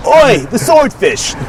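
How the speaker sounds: high, slow cockney oi; hammed-up London punchline; cockney accent.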